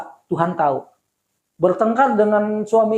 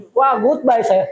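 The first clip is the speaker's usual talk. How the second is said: ordinary talk, nothing marked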